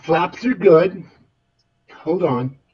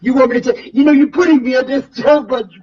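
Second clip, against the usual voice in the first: mock panic; 6 semitones higher, 4 dB louder than usual. Pilot frazzled under pressure.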